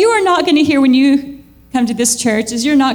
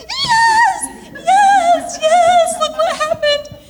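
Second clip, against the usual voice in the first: very high singing yes yes yes; held-back joy finally let loose; ecstatic yes; much slower than usual. Retold or imitated. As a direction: burst out in rejoicing once the door shuts. Celebrating after door shut.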